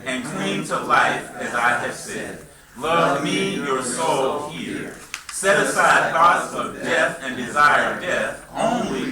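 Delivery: solemn scripture reading. Acted. Teacher reading a soul's speech to students, slow and solemn. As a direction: read it like sacred words to a class. Reads ancient passage aloud.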